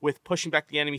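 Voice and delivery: ordinary talk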